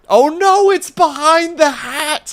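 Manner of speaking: high, loud dismayed wail; sudden drawn-out comic yelp; mock alarm. Real, not acted. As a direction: cry out in exaggerated panic. Sudden mock alarm at screen layout.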